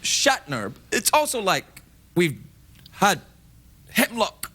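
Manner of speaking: halting, dramatic pauses; Shatner-style delivery. Acted. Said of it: "It's a little bit Shatner".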